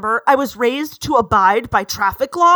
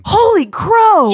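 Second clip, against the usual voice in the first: loud, higher startled cry; alarmed exclamation; 9 semitones higher, 8 dB louder, slower than usual. Acted. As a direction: blurt it out in sudden fright. Bella reacts in reading.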